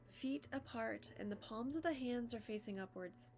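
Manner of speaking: measured yoga nidra guidance, long pauses; even, deliberate meditation-teacher voice; guided meditation. Acted. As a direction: guide them into stillness, phrase by phrase.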